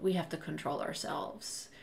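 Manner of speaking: softly acted private aside; hushed retold self-scolding; low-key resolve. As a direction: keep it down, parent to parent. Retold private resolution between parents.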